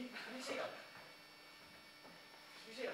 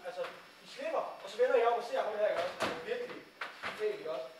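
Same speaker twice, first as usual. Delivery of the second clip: normal talking voice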